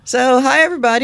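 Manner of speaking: bright, high, loud hello; cheerful greeting. Real, not acted. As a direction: greet the audience with energy. Greeting listeners, show intro.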